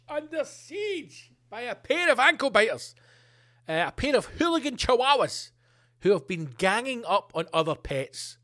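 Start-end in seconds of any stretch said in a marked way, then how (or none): 0.1-5.5 mock dramatic.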